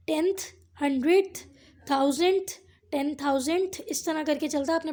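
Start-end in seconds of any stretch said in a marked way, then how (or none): none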